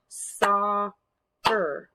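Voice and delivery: SOC, pause, CER; syllable by syllable. Shown as a demonstration. Teacher cueing children to clap, "Soccer" split in two. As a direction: draw "Soccer" out as two separate beats. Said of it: "clap out parts of the words".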